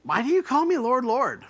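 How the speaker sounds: like he can't believe them; exasperated why, call me Lord Lord; incredulous Jesus. Shown as a demonstration. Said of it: "how is he saying this?".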